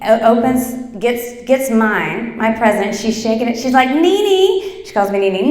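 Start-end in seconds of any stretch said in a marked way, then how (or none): 3.9-4.9 put-on excited child call.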